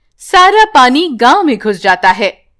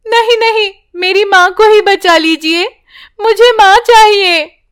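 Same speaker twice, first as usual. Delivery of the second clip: put-on high, desperate girl's voice; a girl begging the tree to save her mother; pleading child; 8 semitones higher, 3 dB louder than usual. (ऊँची, गिड़गिड़ाती बच्ची)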